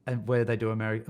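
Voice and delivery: usual speaking voice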